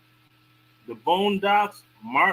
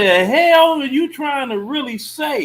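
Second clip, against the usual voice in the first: indignant outburst; 7 semitones higher, 7 dB louder than usual. Real, not acted. Indignant reply to media coded praise.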